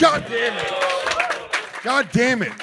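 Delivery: high-pitched exasperated curse; frustrated outburst. Real, not acted. Curses on hearing the answer.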